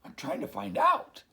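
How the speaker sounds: high, loud, flustered; hammed-up dog voice. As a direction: answer while still hunting. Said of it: "Dog said".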